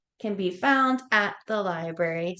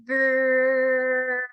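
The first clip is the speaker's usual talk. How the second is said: put-on playful bear growl for kids; 5 semitones higher, 5 dB louder than usual. Teacher playing bear for kids.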